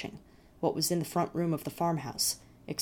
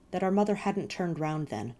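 plain reading voice

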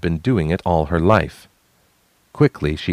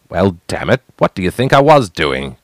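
angry retort; 6 dB louder than usual. Acted. Brother retorting in anger.

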